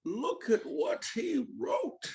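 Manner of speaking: slow, high villain drawl; drawn-out theatrical villain voice; Bane. Acted. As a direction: mimic a masked villain addressing a crowd. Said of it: "he like".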